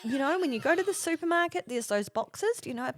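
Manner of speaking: high, patient explaining voice; kindly teacher lilt, starting high; imitated helpful teacher. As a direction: explain it as to a beginner. Teacher explains supermarket baking mixes.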